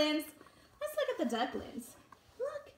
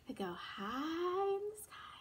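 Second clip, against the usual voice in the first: soft airy whisper; hushed whisper to the child; 2 semitones higher, 8 dB quieter than usual. Acted. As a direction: breathe it out in a whisper. Hushed aside while reading.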